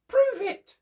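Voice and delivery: very high, loud squeak; chipmunk voice. Acted. Chipmunk daring Bear, very high and loud. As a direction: squeak the dare. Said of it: "And Chipmunk said".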